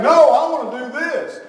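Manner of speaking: high, loud mock child protest; defiant child. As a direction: whine back like a stubborn little girl. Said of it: "Avery's saying".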